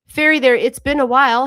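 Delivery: light up as you welcome them back; excited greeting. Real, not acted. Greeting a returning chat viewer.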